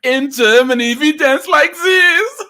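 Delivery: high, shouted mock German accent; thick German accent. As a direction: boast loudly in a hammy German accent. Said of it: "The thick German accents".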